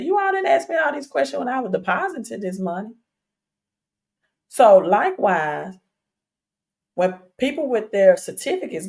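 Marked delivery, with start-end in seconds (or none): 0.0-2.9 higher-pitched, quick retold protest; indignant retort. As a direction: throw it back at them, high and fast. Retorting to bank's questions.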